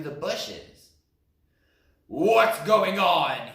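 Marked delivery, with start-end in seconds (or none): 2.1-3.5 loud, slow surprised trumpet; loud, slow elephant trumpet. Acted. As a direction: boom it out in surprise. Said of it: "he trumpeted in surprise".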